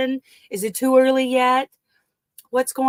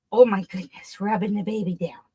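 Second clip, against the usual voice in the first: normal talking voice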